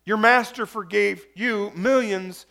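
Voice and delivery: normal talking voice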